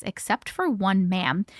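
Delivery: ordinary talk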